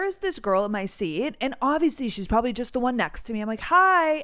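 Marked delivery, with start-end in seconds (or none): 3.6-4.2 high, sweetly polite hello; imitated polite greeting to a stranger. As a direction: put on a courteous, raised-pitch hi. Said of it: "I was trying to be polite".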